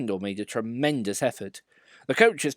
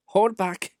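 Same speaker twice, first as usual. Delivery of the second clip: ordinary delivery, nothing marked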